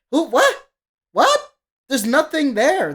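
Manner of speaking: high, loud, baffled outburst; frustrated disbelief. Real, not acted. Listener finding nothing in the song, frustrated and incredulous. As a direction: let the pitch leap up in exasperation. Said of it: "where my frustration as a listener".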